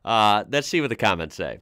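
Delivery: ordinary delivery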